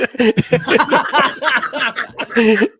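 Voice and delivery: loud, high burst of laughter; real belly laugh; hearty laugh. Real, not acted. Laughing at his own story.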